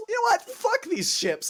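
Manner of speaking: very high, exasperated outburst; mock angry Poseidon. Poseidon deciding to wreck the ships, high mock fury. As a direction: snap into squeaky comic fury.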